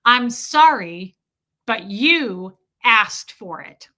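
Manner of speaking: slow, loud, dismissive mock apology; a husband brushing off blame, slowly and loudly; ex-husband's words. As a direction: drag out the fake sorry, loud and slow. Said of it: "What was his words verbatim?".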